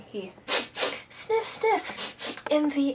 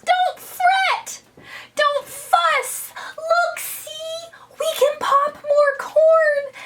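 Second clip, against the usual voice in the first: very high, tiny storybook mouse voice; squeaky mouse; 3 dB louder, slower than usual. Acted. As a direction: squeak it out urgently to console. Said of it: "But Mouse squeaks".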